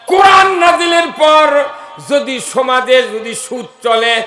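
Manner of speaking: loud, emphatic sermon delivery, last word drawn out long; full-force preaching. Acted. Preacher warning the crowd about usury, full-force preaching. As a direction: preach it loudly, ending in a sustained chant.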